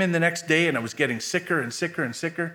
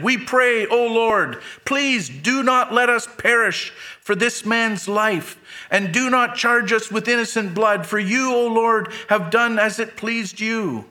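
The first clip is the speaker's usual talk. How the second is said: higher, louder cry to the Lord; frightened sailors crying out to God; desperate prayer; 7 semitones higher, 4 dB louder than usual. Acted. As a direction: plead for your lives, loud and high.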